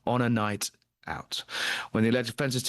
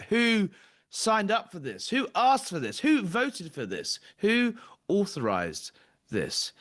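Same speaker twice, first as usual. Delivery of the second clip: indignant rhetorical questions; 7 semitones higher, slower than usual. Real, not acted.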